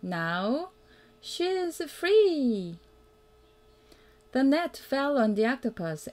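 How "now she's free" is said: usual speaking voice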